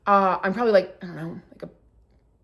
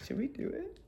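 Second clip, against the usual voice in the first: high, very soft conspiratorial squeak; 9 semitones higher, 14 dB quieter than usual. Retold or imitated. Daughter egging her mother on, conspiratorial and hushed. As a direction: echo it back in a tiny high voice.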